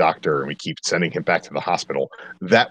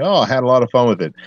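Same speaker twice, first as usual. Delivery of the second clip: mocking quote; 5 semitones higher, 5 dB louder, much faster than usual. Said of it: "apologizing for this movie and saying".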